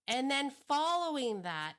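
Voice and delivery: usual speaking voice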